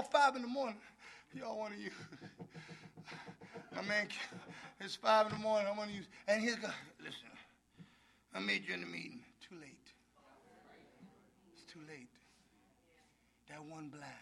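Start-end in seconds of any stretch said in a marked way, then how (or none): 1.3-6.1 much softer, pleading; hushed early-morning plea; desperate caller. Retold or imitated. Quoting addict calling at five. 7.0-14.1 very soft, low voice; hushed, low warning; sponsor on phone. Retold or imitated.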